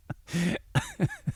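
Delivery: explosive high-pitched cackle; high laugh. Real, not acted. Host cracking up at the listener's line. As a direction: burst out laughing.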